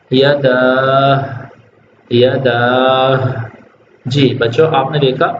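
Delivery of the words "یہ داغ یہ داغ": داغ slowly, ending on the ہ sound; pausal form. Shown as a demonstration. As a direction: stop on داغ and let the ہ sound out. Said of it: "وقف کرنا ہو تو". (داغ پر وقف، ہ کی آواز کے ساتھ)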